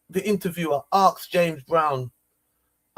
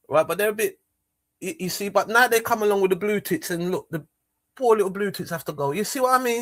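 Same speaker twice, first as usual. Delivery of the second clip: usual speaking voice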